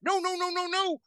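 very high frantic yelping yes; Brutus Beefcake yelping. Retold or imitated. Wrestler bouncing around the ring, squealing high. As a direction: squeal it like a hyped wrestler running. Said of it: "run back and forth going".

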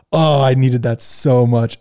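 hint of a goblin sighing in relief; imitating a goblin. Friend in the goblin space, slow, lightly goblin-voiced relief. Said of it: "started talking like a goblin".

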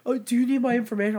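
high, quick imitation of a worried woman; flustered driver. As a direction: mimic her anxious, hurried offer.

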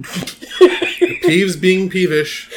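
slow, amused repeat with laughter; laughing. Real, not acted. Cohost laughing at the Peeves pun. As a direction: savor the pun through a laugh.

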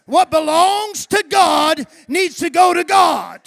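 loud, high shouted preaching; preacher roaring at his church; preaching at full force. Acted. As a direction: thunder it from the pulpit.

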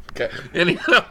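usual speaking voice

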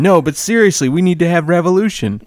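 high, insistent mock woman's voice; put-on high, earnest rally-goer; old lady supporter. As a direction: mimic an old lady pleading her case. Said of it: "And she goes".

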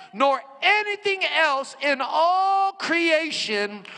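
slow, high, loud proclamation of scripture; a slow booming shout up to the top floor; yelled scripture. Retold or imitated. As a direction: call it out across the cellblock, word by word. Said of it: "He yells this out".

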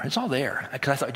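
ordinary talk

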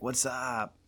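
put-on drawn-out "What's up?" like the old beer ad; Bud Light whats up. Pal imitating the Bud Light ad, drawn-out greeting. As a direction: stretch it like the Bud Light guys. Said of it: "Like the Bud Light commercials".

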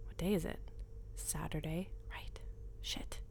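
hushed inner voice, close to the ear; a voice inside her head, whispering; whispered inner voice. Acted. Inner dialogue.

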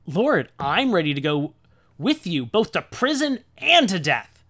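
loud, high-pitched hammed-up vow; mock-heroic, swelling to a big finish; overeager bravado. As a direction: declare it like a gung-ho disciple. Acts Peter's bold vow to Jesus.

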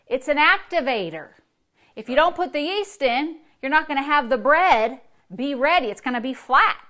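preaching. Acted. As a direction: press the point on the congregation. Preacher drives home yeast image.

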